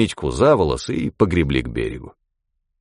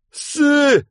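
desperate cry. Acted. Drowning man calls out.

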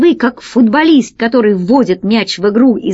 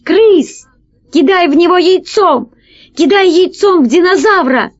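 urgent cry; 8 semitones higher than usual. A narrator's reading. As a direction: call it out urgently to someone far off. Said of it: "закричала". (взволнованный высокий крик)

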